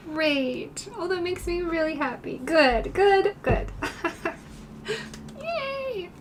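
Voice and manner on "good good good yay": delighted. Real, not acted. Happy reaction to chat.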